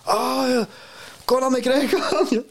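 high, drawn-out mock enthusiasm; excited foreigner. People abroad hearing you're Scottish, mock excited recognition. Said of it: "you tell them you're Scottish".

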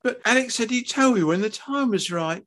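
high, upset mother's voice; pleading, high-pitched wife; tearful protest. Acted. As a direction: defend yourself weepily. Tearful mother defends herself.

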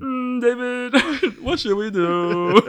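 a pleading alien that can't keep a straight face. Shown as a demonstration. Doing the alien voice again for his friend, high and laughing. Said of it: "just their voices I can do so well".

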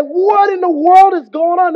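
hammed-up sailor in alarm; freaking out. Sailors in the storm crying out, freaked out. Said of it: "the sailors are freaking out".

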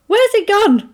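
high-pitched puzzled question; imitating a baffled reply, pitch way up; bewildered question. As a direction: ask in high-voiced confusion. Acted confused question about Philip's death.

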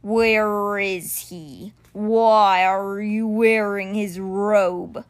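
very slow, forceful creature voice; stone cheetah, demanding. Acted. Stone cheetah demands his creator from the boy, slow and forceful.

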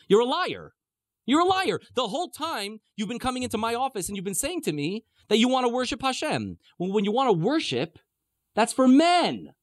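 high-pitched, acted indignation; indignant accusation. Pharaoh accusing Moshe of lying, indignant. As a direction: protest in a high, aggrieved voice.

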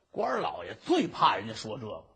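ordinary delivery

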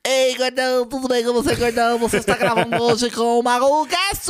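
high squeaky cartoon voice, laughing; cartoon sponge greeting, high and loud; SpongeBob voice. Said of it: "esse tá meio cearense".

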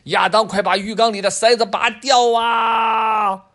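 high, loud, slow pleading yell; desperate shout. Acted. Dad begging Adam to pull the plug, slow desperate shout. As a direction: beg him at the top of your voice. Dad's desperate final plea.

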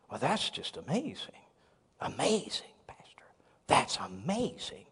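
breathless hushed whisper of amazement; awed whisper. Retold or imitated. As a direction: whisper it like he's starstruck. Awed guest whispering praise.

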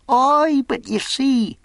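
high, loud character voice; bargaining castaway. Acted. Castaway clarifies his terms to Jim, high and insistent. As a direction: cut in as an eccentric old sailor.

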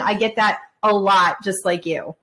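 ordinary delivery

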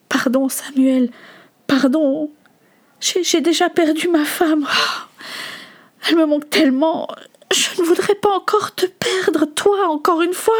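high, distressed apology; anguished pleading. Acted. Father who lost his wife begs his son, anguished. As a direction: beg forgiveness, pitch climbing with grief.

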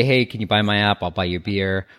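usual speaking voice

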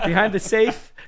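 high, awkward punchline; sheepish mock excuse. Acting out where visitors find the clothes, sheepish and high-pitched. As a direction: tack on the embarrassed detail in a high voice. Finishing the acted excuse, amid laughter.

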